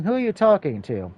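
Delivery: plain reading voice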